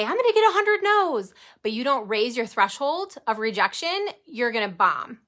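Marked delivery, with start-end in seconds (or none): 0.0-1.3 much higher and louder, imitating an eager newbie; hammed-up brave declaration; mock gung-ho vow. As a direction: play the overconfident recruit bragging. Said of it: "and say".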